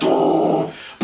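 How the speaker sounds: long, rough vocalised guitar drone; imitated guitar riff. Host shows the riff, imitated guitar growl. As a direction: mouth the heavy riff as noise. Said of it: "that's literally the riff".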